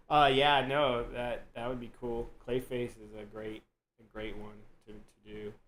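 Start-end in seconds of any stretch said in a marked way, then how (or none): none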